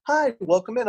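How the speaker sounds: a light hostess voice; imitated host greeting. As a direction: do the cheerful hostess at the door.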